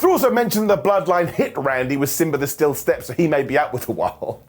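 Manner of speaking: ordinary talk, nothing marked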